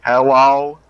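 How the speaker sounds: loud calling hello. Real, not acted. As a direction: call out loudly into the phone. Checking if anyone hears.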